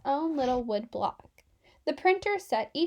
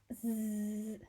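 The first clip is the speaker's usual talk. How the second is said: soft sleepy snore sound; snoring; 3 semitones lower, 10 dB quieter than usual. A narrator's reading. Collapsing letter dozes off, soft snore. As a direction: drift off with a quiet snore.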